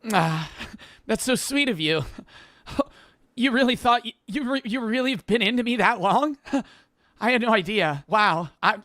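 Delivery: high, breathless mock anime girl; flustered anime girl. A friend mocks anime romance scenes as a flustered girl. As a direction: gush like a blushing anime heroine. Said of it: "they could be, like, sitting down being like".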